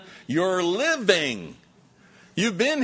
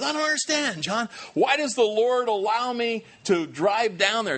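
high, pleading, puzzled voice; whiny troubled man; 7 semitones higher, 4 dB louder than usual. Retold or imitated. Counseled man whining to his pastor, high and pleading. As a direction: ask it like a lost man begging for answers.

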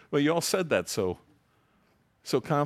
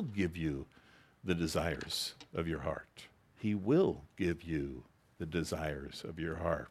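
quiet promise; 6 semitones lower, 7 dB quieter than usual. Shown as a demonstration. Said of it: "For those of you who don't believe that".

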